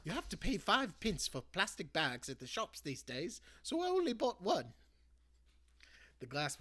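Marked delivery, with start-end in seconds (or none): 0.0-4.7 a hint of a chatty old granny, higher; lightly voiced granny. Granny explaining the carrier bag to Ben, lightly voiced. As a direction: gently explain like a thrifty grandmother.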